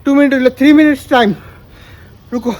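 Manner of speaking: words pushed out between breaths. Real, not acted. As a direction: still panting after skipping. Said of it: "heartbeat fast হয়ে গেছে".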